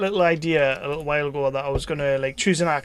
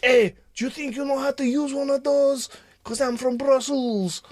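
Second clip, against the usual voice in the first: put-on terrible Brussels accent; 9 semitones higher than usual. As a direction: do a bad, exaggerated Brussels accent, pitched up. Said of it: "That was terrible as well".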